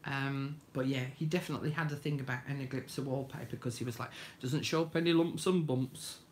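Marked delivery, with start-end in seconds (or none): 4.4-6.2 put-on Yorkshire accent. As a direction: quote dad with a Yorkshire accent. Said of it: "he didn't say it with a Yorkshire accent".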